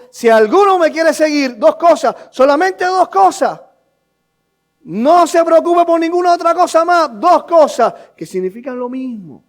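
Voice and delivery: high, loud, urgent sermon; insistent pulpit exhortation; preaching at full force. Real, not acted.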